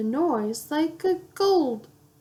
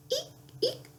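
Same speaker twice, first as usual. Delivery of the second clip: very high mouse squeak; squeaky eek; 9 dB quieter than usual. A narrator's reading. Reading to a child, a high squeak. Noise words in picture book.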